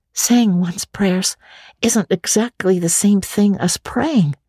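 put-on slow, high thoughtful girl; meditative Anne. Anne ponders prayer aloud, slow high girlish voice. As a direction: muse on it slowly. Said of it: "said Anne meditatively".

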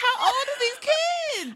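high, drawn-out incredulous cry; mock outrage. Podcaster reacting to the teenagers' antics, mock-outraged. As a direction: wail it in exaggerated disbelief.

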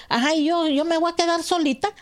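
high, loud mock outrage; indignant protest. To the friends threatening to jump, high indignant protest. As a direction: throw it back at them, half joking.

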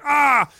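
high mock yelp of pain; mock scream. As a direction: yelp it out high like the snap just hurt. Retold scream on snap.

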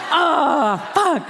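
high laughing curse at herself; laughing exclamation. Real, not acted. To the audience, laughing at her own bluff. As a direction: laugh it out, half groaning.

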